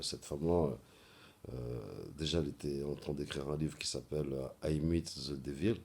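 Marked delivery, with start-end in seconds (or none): 4.7-5.8 English title with a thick French accent; accent français en anglais. Real, not acted. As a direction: mangle the English words through a French accent. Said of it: "Désolé pour mon accent anglais complètement flingué". (anglais avec un fort accent français)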